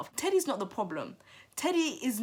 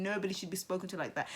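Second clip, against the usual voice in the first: quick muttered repeat; 6 dB quieter, faster than usual. Real, not acted. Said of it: "regardless of anything".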